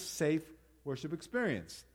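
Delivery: ordinary talk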